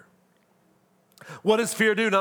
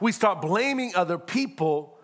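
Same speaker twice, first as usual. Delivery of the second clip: ordinary talk, nothing marked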